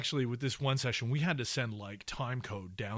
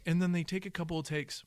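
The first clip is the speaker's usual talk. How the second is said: usual speaking voice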